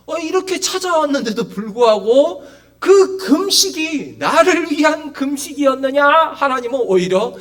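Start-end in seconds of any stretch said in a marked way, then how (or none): 2.8-6.0 high, loud stern reproach; forceful scolding question; rebuking. Acted. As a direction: challenge them like a God calling His people out. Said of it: "책망을 하시는 거예요".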